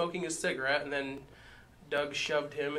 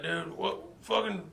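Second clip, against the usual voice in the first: put-on drunk confused; 8 semitones higher than usual. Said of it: "He's like".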